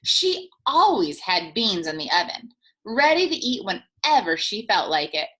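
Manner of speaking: ordinary delivery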